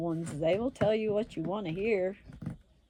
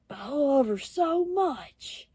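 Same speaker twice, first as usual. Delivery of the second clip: higher, slow, drawn-out pleading; mock whiny lovesick; 8 semitones higher, 4 dB louder, slower than usual. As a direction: mimic a moping guy begging. Lovesick guy pleading again.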